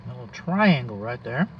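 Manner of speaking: ordinary delivery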